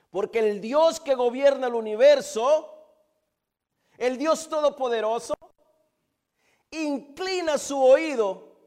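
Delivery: loud, high, ringing proclamation; thundering pulpit declaration; fervent preaching. Real, not acted. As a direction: proclaim it at full force, pausing between phrases. Preaching at full force.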